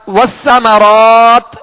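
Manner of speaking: slow والثمرات with a long aa closed by t; full t stop. Shown as a demonstration. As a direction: stretch the end of والثمرات, "raat". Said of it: "اس تا کو ساکن کر دیں گے".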